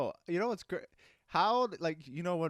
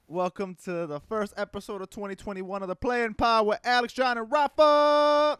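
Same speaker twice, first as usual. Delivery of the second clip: rising, louder hype intro; announcer voice; 8 semitones higher, 7 dB louder than usual. Acted. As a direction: build it up like a host. Hyped show opening.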